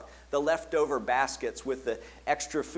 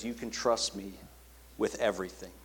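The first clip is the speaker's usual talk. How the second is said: tender reassurance; 5 semitones lower, 6 dB quieter than usual. Acted. Jesus assuring listeners.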